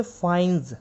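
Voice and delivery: फाइन्स ending in a buzzing z; फाइन्स with final z, not s; z sound at end. Shown as a demonstration. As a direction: say फाइन्स with a voiced z, fainz. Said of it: "जेड की यहाँ पे sound आएगी".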